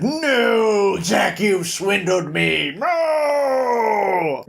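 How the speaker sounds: hammed-up loser's cry; wailing devil. Beaten devil protests to Jack, mock wailing.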